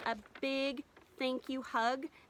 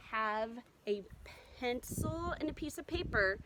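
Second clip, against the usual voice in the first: ordinary talk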